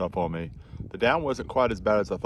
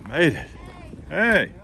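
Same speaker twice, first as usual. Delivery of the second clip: calling out; 9 semitones higher, 4 dB louder, slower than usual. Real, not acted. Greeting hikers on arrival.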